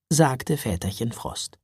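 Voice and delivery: plain reading voice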